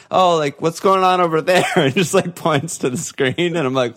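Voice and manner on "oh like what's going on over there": higher-pitched, playful mock curiosity; hint at it with put-on innocence; sly feigned innocence. Friend who knows the score tips off the bar, sly feigned innocence. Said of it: "he just slyly is like".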